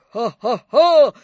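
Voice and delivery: loud, slow stage laugh; frightful guffaw. A narrator's reading. Napoleon mocking Death, frightful slow guffaw. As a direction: laugh out three heavy, spaced ha's. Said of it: "хохотал страшно Наполеон".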